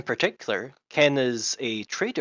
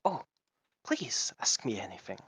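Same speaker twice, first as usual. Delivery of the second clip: put-on lizard accent; 6 dB quieter than usual. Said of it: "doing a lizard accent".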